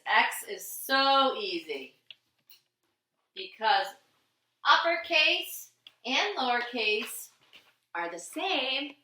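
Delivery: teacher voice. Shown as a demonstration. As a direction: explain it sweetly to little kids. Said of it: "explains to children".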